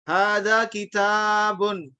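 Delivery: Hadza kitabun very slowly, the ta in kitabun held long; long vowels. Shown as a demonstration. Teacher models long ta, very slowly. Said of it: "Taknya mesti panjang".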